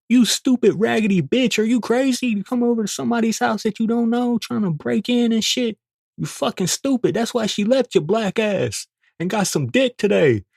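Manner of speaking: high furious insults; retold tirade. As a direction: tear into her on the lawn. Cursing out the intruder.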